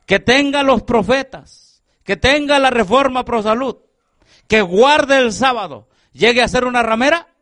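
rising, ringing sermon questions; preaching at full force. Acted. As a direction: hammer each question home to the congregation. Preacher builds to climax.

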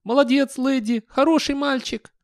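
very high, gleeful doggy squeal; high-pitched happy yapping words; excited dog voice. Acted. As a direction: talk like an overjoyed simple dog. Gleeful dog praising himself, yapping.

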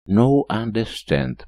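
slow broken English with a shrug; foreign accent. Acted. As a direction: play dumb, slow and foreign. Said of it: "пожал я плечами".

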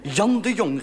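scolding call of his name; reproachful call. Acted. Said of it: "riep ik verwijtend".

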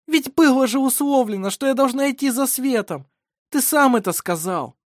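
high, loud, aggrieved voice; indignant protest. A narrator's reading. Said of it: "крикнула она возмущённо". (возмущённый высокий протест)

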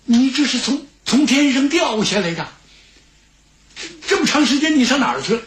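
high, hurried astonishment; amazed old steward. Acted. Old steward to his long-absent friend over wine, amazed. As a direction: question a long-lost friend, ending in a rush.

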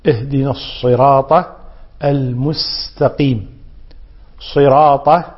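slow, separated الصراط with heavy consonants; sad and ta. Shown as a demonstration. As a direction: recite الصراط deliberately for learners. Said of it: "بالصاد ثم بالطاء".

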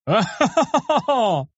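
high, drawn-out performed laugh; acted peal of laughter; crowd laughter. A narrator's reading. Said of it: "раздался смех со всех сторон". (протяжный наигранный хохот)

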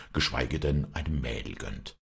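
plain reading voice

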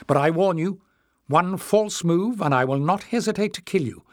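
stern threat. Acted. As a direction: warn him off, hard and high.

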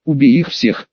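ordinary delivery

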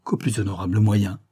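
ordinary delivery, nothing marked

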